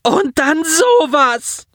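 high, slow anguished cry; a grieving boy crying out in despair; near scream. A narrator's reading. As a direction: let the pain burst out in one high, drawn-out cry. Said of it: "Er schrie förmlich".

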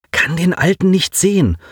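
plain reading voice

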